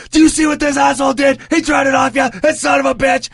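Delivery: very high, strained outraged shout; imitated furious yelling. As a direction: rant at a witness in a rage. Acts out enraged fighter turning on him.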